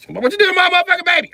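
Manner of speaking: high, loud, fast mother shout; mama yelling. Retold or imitated. The mom yelling at the girlfriend. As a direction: demand it like an outraged mom. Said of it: "mama yelling".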